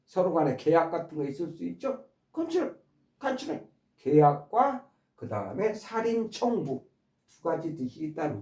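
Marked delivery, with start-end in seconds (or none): 2.3-3.7 컨트랙 high and lightly said; teacher tossing out the example word; stressed demo word. Shown as a demonstration. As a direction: pitch the word 컨트랙 way up, twice. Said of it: "이 강세에 의해서 컨트랙트냐".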